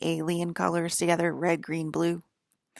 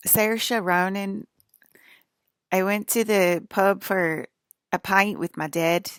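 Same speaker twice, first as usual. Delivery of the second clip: slow, drawn-out attempt at an Irish accent; 3 semitones higher, 6 dB louder, slower than usual. Shown as a demonstration. Vlogger trying to sound Irish like her dad, Irish accent. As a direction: try on an Irish lilt, slowly and loudly. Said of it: "let me try to do the Irish accent".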